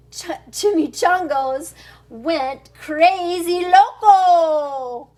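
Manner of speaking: ordinary delivery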